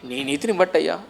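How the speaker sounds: high, loud, fervent pulpit delivery; a rising, loud sermon cry; full-force preaching. Acted.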